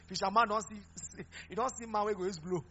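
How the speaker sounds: ordinary delivery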